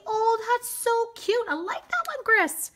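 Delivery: high, drawn-out cooing approval; delighted gushing. Real, not acted. Gushes over a viewer's placement.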